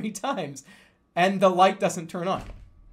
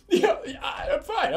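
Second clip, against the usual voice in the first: high, giggly reassurance; nervous laughing yeah I'm fine; 11 semitones higher, slower than usual. Real, not acted. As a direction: laugh while brushing it off. Playing it off to girlfriend.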